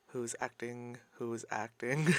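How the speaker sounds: very soft, under the breath; hushed, almost whispered. Real, not acted. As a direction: murmur it to yourself. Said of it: "repeated hushed then aloud".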